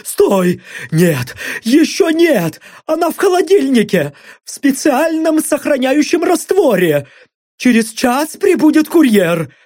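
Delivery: high frantic pleading; panicked begging. Acted. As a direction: blurt it all out. Blond begs in panic.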